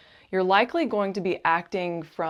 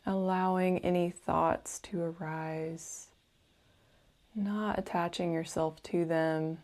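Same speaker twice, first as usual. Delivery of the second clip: guided meditation; 4 dB quieter than usual. Acted. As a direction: gently guide the listener into calm, unhurried and soothing.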